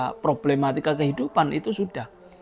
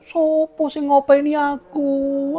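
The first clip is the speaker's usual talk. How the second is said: much higher, whiny mock wailing; a hammed-up lament; mock crying; 9 semitones higher, slower than usual. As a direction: imitate someone sobbing over a dead parent. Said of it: "nangis-nangis".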